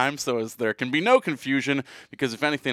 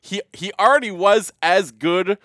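higher and louder, pushing the point; heated rebuttal; 8 semitones higher, 7 dB louder, slower than usual. Real, not acted. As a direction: argue it hot, stressing already. Arguing back at fans.

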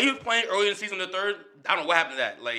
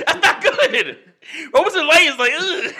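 high, loud laughing groan; laughing disbelief; 10 semitones higher, 7 dB louder than usual. Real, not acted. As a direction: crack up while calling it bad. Laughing at friend's Roberson light jab.

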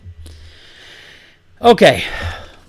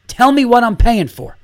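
high, loud outraged ranting; an exasperated dad yelling his disbelief; furious rant; 7 semitones higher, 4 dB louder than usual. Real, not acted. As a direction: blow up at the camp, pitch up and shouting. Ranting about son's camp.

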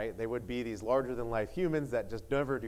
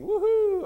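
high, loud "woohoo"; excited whoop; 8 dB louder than usual. Real, not acted. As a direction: whoop it out to the congregation. Cheering the snow before church.

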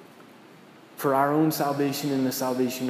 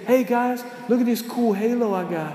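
breezy, much higher joking voice; mock casual Jesus; 6 semitones higher than usual. Preacher mocks what Jesus didn't say, high and breezy. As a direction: play it like a dude showing off a new toy. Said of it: "He didn't say".

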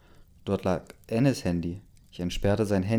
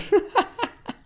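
high-pitched burst of laughter; sudden high giggling fit; real laugh; 4 dB louder than usual. Real, not acted. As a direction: crack up mid-reading. Cracks up while reading.

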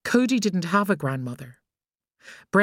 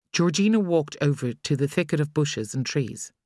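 plain reading voice

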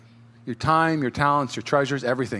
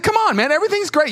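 upbeat encouragement; 6 dB louder than usual. Retold or imitated. Mentor cheering up the pastor.

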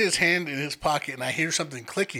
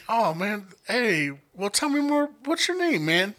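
much higher, overly friendly coaxing; fake friendly; 6 semitones higher than usual. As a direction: play it sweet and high to calm him. Bouncer coaxing crying man.

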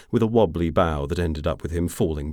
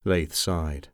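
plain reading voice

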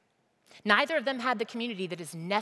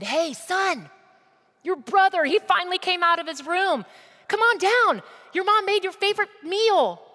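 loud, high call to a son in another room; excited father calling his son down to dinner; calling upstairs; 12 semitones higher, 8 dB louder than usual. Acted. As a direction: shout it up the stairs, much higher and louder. Dad calls son downstairs.

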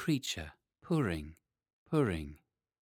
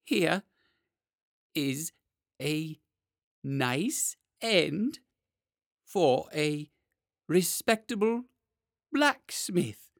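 each word apart, high and clear; very distinctly; 7 semitones higher, 3 dB louder, much slower than usual. Acted. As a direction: space out every word with a pause. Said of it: "very distinctly".

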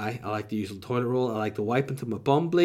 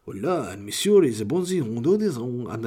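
Spanish sliding into French, swooping pitch; hammed-up Spanish accent slipping into French; mock Spanish accent; 4 dB louder, slower than usual. Shown as a demonstration. Self-styled master of Spanish botches it, muddled Spanish accent. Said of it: "I'm the Spanish voiceover guy".

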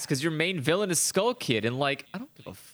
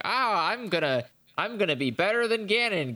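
boastful villain voice; 6 semitones higher than usual. Retold or imitated. Voicing boastful painting villain.

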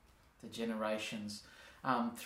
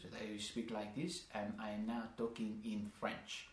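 soft, low sitcom French accent; English spoken with a put-on French accent, quieter and lower; 4 semitones lower, 8 dB quieter than usual. Shown as a demonstration. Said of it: "they'd speak in a French accent".

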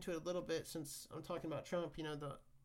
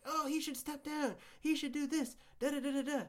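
higher, mocking whine of outraged critics; mock whiny Democrats; 8 semitones higher, 4 dB louder than usual. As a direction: parody them with a raised, whiny sing-song. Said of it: "Democrats are like".

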